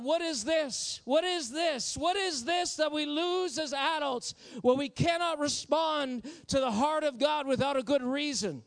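rising fervent sermon delivery; impassioned preaching. Acted. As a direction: preach it out, pleading with the congregation. Preaching at full force.

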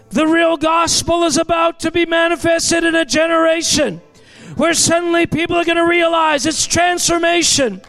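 full-force revival preacher; fiery preaching. Real, not acted. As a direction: preach it out to the whole room.

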